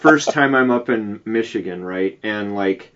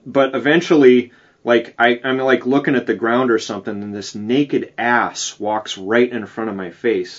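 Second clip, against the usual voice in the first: ordinary talk, nothing marked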